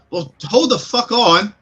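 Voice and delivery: loud, high, slow barked warning from behind the door; a big warrior woman annoyed at being interrupted; booming irritated shout; gruff Goliath woman. Acted. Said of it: "she says".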